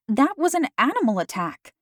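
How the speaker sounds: high, sharp exclamation; riled-up outburst; exclaimed. A narrator's reading. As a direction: cut her theory down fast. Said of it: "I exclaimed".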